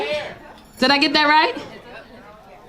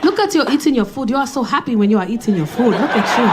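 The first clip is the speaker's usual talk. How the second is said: put-on African accent compliments. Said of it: "He was like".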